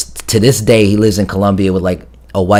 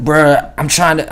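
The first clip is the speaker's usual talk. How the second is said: high strained voice; 7 semitones higher, slower than usual. Retold or imitated. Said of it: "he's like".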